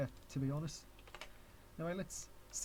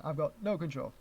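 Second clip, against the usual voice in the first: ordinary delivery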